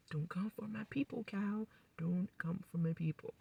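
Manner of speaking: breathy whisper threat; whispered warning. Retold or imitated. Summer warning Cal about her people, in a whisper.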